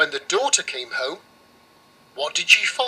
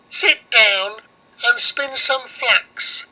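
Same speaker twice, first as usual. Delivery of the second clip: louder, slow witch command; 6 semitones higher, 5 dB louder, slower than usual. Acted. Baba Yaga orders the girl to spin, slow and loud.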